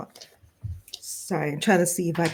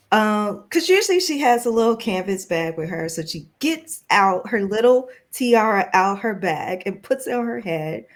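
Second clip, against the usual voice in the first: normal talking voice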